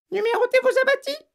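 very high, quick parrot voice; cavernous parrot. A narrator's reading. Parrot showing off to Matilda, cavernous squawk. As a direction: squawk it fast and loud, oddly hollow. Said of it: "avec d'étonnantes intonations caverneuses".

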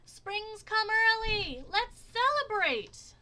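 high, slow, loud shout to the sky; yelled. A narrator's reading. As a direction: bellow it out to the whole hill. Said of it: "opened his mouth and yelled".

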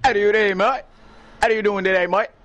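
put-on Aussie greeting; Australian accent. Mimicking how an Australian friend talks, Australian accent. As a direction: greet them like an Aussie mate. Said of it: "That's an accent".

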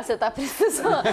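teasing through a laugh. Real, not acted.

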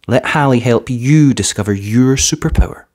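plain reading voice

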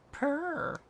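high purr; cat purr. Acted. Cat agreeing to the house plan with a high purr.

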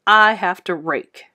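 through gritted teeth. A narrator's reading. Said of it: "through gritted teeth".

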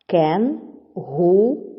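"Кен-гу-ру" slowly, one syllable at a time; teacher's slow syllable count on "Кен-гу-ру"; split into syllables. Shown as a demonstration. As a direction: clear pauses inside "Кен-гу-ру": Кен... гу... ру. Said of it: "чи правильно поділили".

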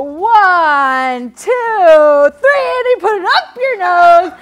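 escalating seminar hype shout; rousing count-off. Shown as a demonstration. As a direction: build from loud to a high yell. Demonstrates seminar count, building.

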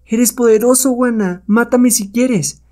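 high, drawn-out voice of submission; surrendering witch. Acted. Witch yields to the hero's greater power, surrendering. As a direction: give yourself up, pitched high.

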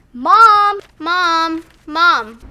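loud worried call. Acted. Child calling out for mom.

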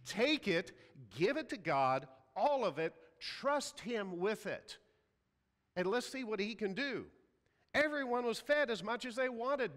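loud, rising sermon delivery; full-force preaching. Acted. Pastor urging the congregation to trust God, preached loud. Preaches the feeding of five thousand.

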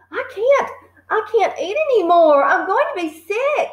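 put-on high, pleading boy voice; desperate boy. A narrator's reading. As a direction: whine it as if about to be sick.

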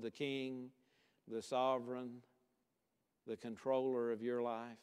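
hushed-down, level invitation to pray; even monotone with long reverent pauses; solemn prayer. Real, not acted. Preacher leading the congregation in closing prayer, solemn prayer.

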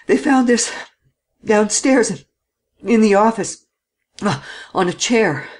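panting between phrases, winded; out of breath. A narrator's reading. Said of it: "all out of breath".

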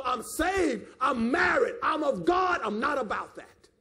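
firm preacher's proclamation, each claim punched out; strong, forceful refusal of temptation; bold, empowered declaration. Acted. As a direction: declare it with conviction, no hesitation. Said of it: "it take power to talk like that".

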